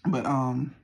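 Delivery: ordinary talk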